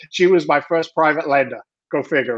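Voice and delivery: ordinary delivery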